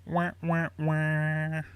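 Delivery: high, loud wah wah wah, teasing; mock sad trombone. Quizmaster teasing the players who lost points, mock sad trombone.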